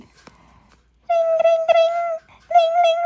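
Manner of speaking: high, loud ring ring; phone ring imitation. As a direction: mimic a ringing phone.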